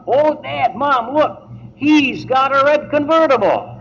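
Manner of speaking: high-pitched acted shout to the parents; excited girl shouting. Girl at the window calling Dad and Mom, excited shout. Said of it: "and she shouts".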